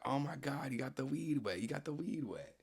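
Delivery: high-pitched, alarmed imitation of friends; mock panicked friends. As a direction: squeal it like a friend panicking over the weed. Friends panicking about wet weed.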